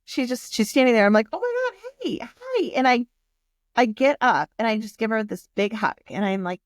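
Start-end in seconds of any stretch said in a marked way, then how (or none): 1.3-2.7 delighted greeting. Retold or imitated. Guest seeing the helpful manager at the table, delighted. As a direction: greet with surprised delight, pitched way up. Retold surprise greeting of her helper.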